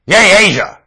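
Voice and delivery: high, loud mocking cheer. Preacher mocking the world, a high sarcastic cheer. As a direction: cheer it out in scorn.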